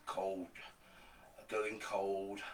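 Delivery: ordinary talk, nothing marked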